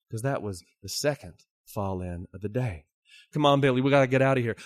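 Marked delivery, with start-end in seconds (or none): 3.3-4.6 fast, louder urging; urgent urging. Acted. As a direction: hurry him out of the mine. Miners urging Billy to flee.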